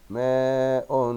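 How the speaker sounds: "ماء" stretched loudly as "maaa-un"; slow, loud ماء with nunation; tanween damma ending. Shown as a demonstration. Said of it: "الكلمات التالية بالضم".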